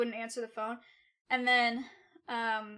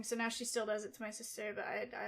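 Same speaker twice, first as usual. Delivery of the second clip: ordinary talk, nothing marked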